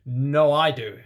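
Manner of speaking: loud, high shout. A narrator's reading. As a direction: yell it back at him.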